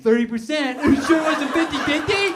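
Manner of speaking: loud high taunting sing-song; mock him across the office; mocking coworkers. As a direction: coworkers jeering loudly.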